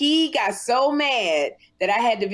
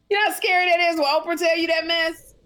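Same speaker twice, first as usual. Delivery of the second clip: excited outburst; 7 semitones higher, 4 dB louder, faster than usual. Real, not acted. Reacting to Oprah's warning.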